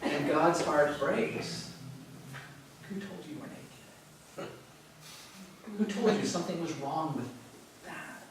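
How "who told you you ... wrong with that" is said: whispered, aching question from a grieving God; heartbroken whisper. Acted. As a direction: breathe it out softly, heart breaking. God heartbroken asking Adam and Eve.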